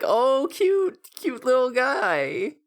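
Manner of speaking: high-pitched coo, drawn out at the end; imitated cooing. Anna meeting Gamamon after seeming scared, imitated high cooing. Quote of charmed girl meeting creature.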